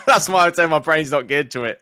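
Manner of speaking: amused at yourself, laughing while saying it. Real, not acted. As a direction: laugh as you explain. Laughing about own learning style.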